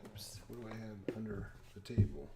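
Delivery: unvoiced hushed whisper; soft surprised whisper to self. Real, not acted. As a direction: whisper it as if finding something hidden. Surprised find under table.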